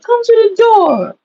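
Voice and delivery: high-pitched, drawn-out summons; calling out. Retold or imitated. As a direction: call them to the door, high and slow. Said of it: "she was calling me".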